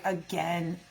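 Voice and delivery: low, soft, fed-up mutter; weary cat voice. Retold or imitated. Cat's inner voice, fed up with grooming, low and soft. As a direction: give a bored sigh of a word. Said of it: "He's just like".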